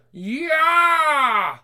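high long shouted scream; horrified scream. Acted. Patient screams seeing himself in the mirror. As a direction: scream at the sight.

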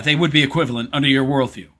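ordinary talk

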